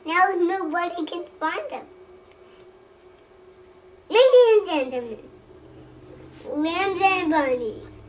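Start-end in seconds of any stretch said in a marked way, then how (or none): 4.1-8.0 playful announcer. Pretend. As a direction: announce it grandly like a show host. Child grandly announces the sticker page.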